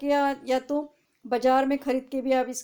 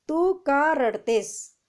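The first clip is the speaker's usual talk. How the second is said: louder and very slow; very slow reading aloud; 4 semitones higher, 5 dB louder, much slower than usual. Shown as a demonstration. Teacher reading an example sentence to students, very slow. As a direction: drag the sentence out for the class. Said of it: "आपको पढ़ के भी दिखाती हूँ".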